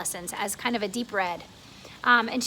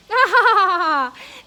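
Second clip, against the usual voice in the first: roar of laughter; 7 semitones higher, 11 dB louder than usual. Acted. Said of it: "a roar of laughter from all sides".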